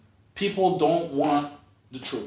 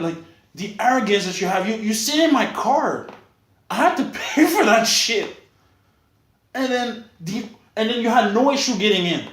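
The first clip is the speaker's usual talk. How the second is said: heated rant; 6 semitones higher, 3 dB louder than usual. Real, not acted. Ranting angrily at friend's arrogance.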